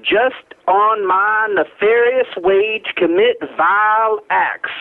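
slow, drawn-out Southern oratory; Southern accent. Acted. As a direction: recite it like a Southern orator savoring bourbon. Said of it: "the Southern accent, my own Southern accent".

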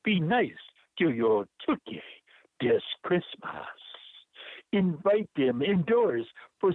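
lilting Jamaican performance poetry; rhythmic Jamaican accent with swooping pitch and stretched rhymes. Acted. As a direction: recite in your best put-on Jamaican accent. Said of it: "I use my best Jamaican accent".

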